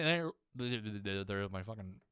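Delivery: ordinary talk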